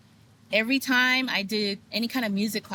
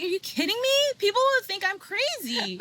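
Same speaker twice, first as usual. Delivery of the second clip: much higher, mock-incredulous outburst; retold disbelief; 13 semitones higher than usual. Worrying what people will think of her awakening, high incredulous outburst. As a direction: pitch it way up in disbelief.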